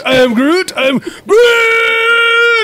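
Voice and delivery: building from loud to a very high, slow wailing cry; crying Groot. Pretend. Mocking a porn parody, building to a high hammed-up wailing cry.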